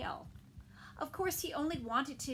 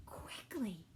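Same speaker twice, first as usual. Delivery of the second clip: whispered; 4 semitones lower, 7 dB quieter than usual. A narrator's reading. Whispered word.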